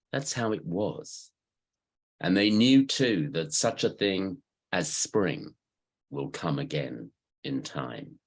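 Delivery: deliberate recital, pitch lifting then falling away; poem reading. Acted. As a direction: recite in measured phrases, leaning on key words. Reads own poem to group.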